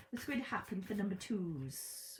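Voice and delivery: a light, half-hearted Welsh lilt; a bit Welsh. Pretend. Reader asking viewers the question, light Welsh lilt. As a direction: slip into a touch of Welsh. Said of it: "that sounded a bit Welsh then".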